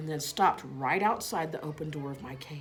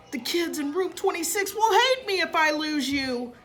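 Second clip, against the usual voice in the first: higher, worried voice of a principal in a panic; panicked; 12 semitones higher, 6 dB louder than usual. A narrator's reading. Principal afraid the class will hate him, panicky. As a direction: fret aloud, higher and louder. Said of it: "In a panic".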